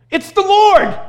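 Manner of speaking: high, loud joyful cry of recognition; excited cry. Retold or imitated. Peter spotting Jesus on shore, high loud excited cry. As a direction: shout it out as if spotting a friend.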